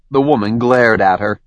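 plain reading voice

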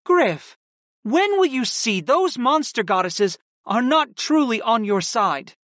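measured older woman, lightly voiced; aunt Kristen voiced. A narrator's reading. Aunt explaining to her nephew, lightly voiced warning.

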